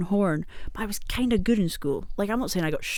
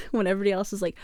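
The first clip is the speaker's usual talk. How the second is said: normal talking voice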